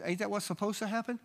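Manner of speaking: put-on a small boy asking innocently; innocent child. Little boy asks the man if that's normal. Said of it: "He said".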